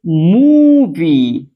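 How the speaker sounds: मूवी held very long and loud; drawn-out model word. Shown as a demonstration. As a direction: stretch the oo in मूवी. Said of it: "इसे पढ़ते हैं". (मूवी को बहुत खींचकर)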